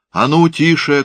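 high loud crowd hush; shout. A narrator's reading. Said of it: "крикнул глашатай".

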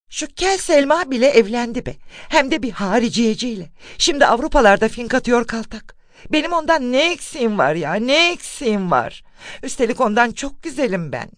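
high-pitched, indignant complaint with wailing emphasis; envious outburst. A narrator's reading. Spinster protesting a rival's marriage, indignant and envious. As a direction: rail enviously, voice pitched well up.

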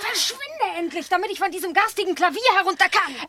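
high, fast, impatient; irritated command. Acted. Tiger on the piano orders the dog to leave, irritated. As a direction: hurry the dog away, annoyed.

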